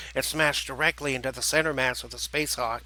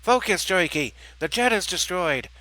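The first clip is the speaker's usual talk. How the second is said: raised, loud, exasperated voice; frustrated bark; 5 semitones higher, 5 dB louder than usual. Acted. Turkey snapping his brother back to focus, frustrated bark. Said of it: "Lurky barked out in frustration".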